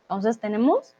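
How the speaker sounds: ordinary delivery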